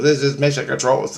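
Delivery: a hint of a computer on the line. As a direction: report in like a talking computer. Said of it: "it's a computer talking".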